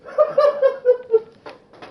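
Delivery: high loud cackling laugh; big burst of laughter. Real, not acted.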